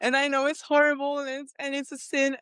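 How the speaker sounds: re-enacting a distraught plea, no real sobs; retold crying. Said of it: "I was crying and crying".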